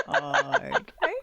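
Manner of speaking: high, slow, startled "oh okay"; mock taken aback. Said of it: "I'm like".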